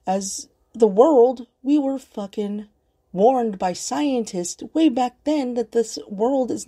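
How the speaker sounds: slow, high, insistent emphasis; emphatic slow warning. Real, not acted. Vlogger warning her viewers about climate, slow and emphatic. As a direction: lean hard on every word.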